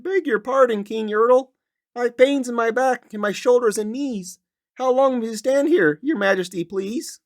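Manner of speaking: high, small, polite turtle voice; humble turtle in pain begging the king; timid little turtle. Acted. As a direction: ask the king meekly from under the stack. Turtle Mac speaks up.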